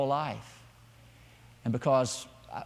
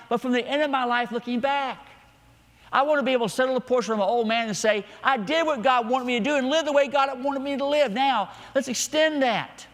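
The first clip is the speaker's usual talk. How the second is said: high, lifted preacher's voice; fervent preaching; 9 semitones higher, 4 dB louder than usual. Real, not acted. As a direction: declare it with conviction to the congregation. Preaching with raised pitch.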